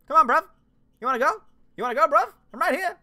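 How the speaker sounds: high, loud "come on" challenge to a fight; mock tough-guy taunt. Taunting Disney to come fight him, loud mock bravado. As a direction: square up and shout the dare.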